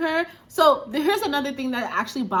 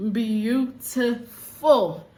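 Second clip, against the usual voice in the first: drawn-out admiring; much slower than usual. Real, not acted. Gushing over actress's looks.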